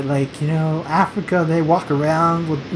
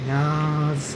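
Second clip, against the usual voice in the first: ordinary talk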